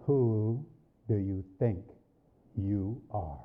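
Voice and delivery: low, measured voice from above; clear, distinct voice. Retold or imitated. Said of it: "distinct voice".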